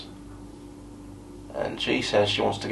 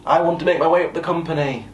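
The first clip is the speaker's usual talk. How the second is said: shouting over music; 7 semitones higher, 6 dB louder than usual. Retold or imitated. Drunk new colleague declaring ambition to her boss over club music, shouting. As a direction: imitate her yelling over loud music. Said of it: "shouting over the music".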